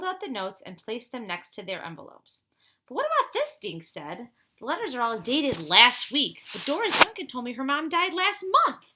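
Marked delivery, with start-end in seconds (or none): 2.9-3.6 high, sharp puzzled question; puzzled exclamation. Acted. Dink, spotting the date problem, high and puzzled. 4.6-8.8 louder, higher, urgent explaining; excited realization. Acted. Dink to friends about the dates, loud and excited.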